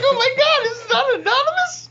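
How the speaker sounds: very high, loud squeal of dismay; hammed-up horrified shriek; comic panic. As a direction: shriek it in mock alarm. Acting out his panic, comic.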